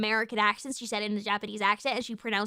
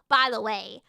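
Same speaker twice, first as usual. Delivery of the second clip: normal talking voice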